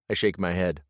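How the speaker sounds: ordinary delivery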